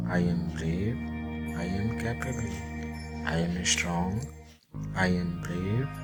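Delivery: ordinary delivery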